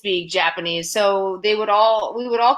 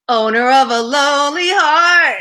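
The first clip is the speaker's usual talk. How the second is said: loud, high fan excitement; starstruck outburst; 7 semitones higher, 9 dB louder than usual. Retold or imitated. Young model recognizing a famous singer, starstruck outburst.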